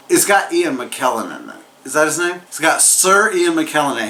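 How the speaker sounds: ordinary talk, nothing marked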